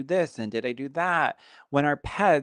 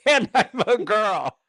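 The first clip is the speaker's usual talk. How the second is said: laughing mock echo; 11 semitones higher, 4 dB louder than usual. Real, not acted. Teasing echo of friend's line.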